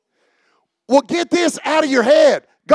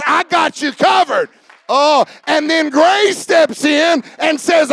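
preaching at full force; 3 semitones higher than usual. Acted. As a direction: build the congregation up with fervor.

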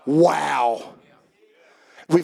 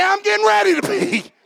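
call-out; 13 semitones higher than usual. Shown as a demonstration. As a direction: shout it for them to repeat.

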